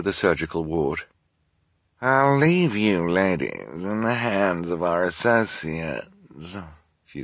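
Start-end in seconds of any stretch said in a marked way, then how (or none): 2.0-6.6 slow, purring villain voice; sinister villainess. Acted. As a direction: hand them over with menace. Esme's villain voice.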